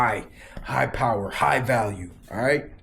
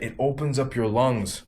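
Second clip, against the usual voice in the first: usual speaking voice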